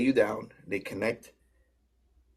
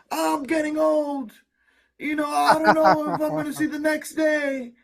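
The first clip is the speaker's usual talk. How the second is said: put-on old person voice; 3 dB louder than usual. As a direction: voice a worried old fan. Mimicking people asking about med beds.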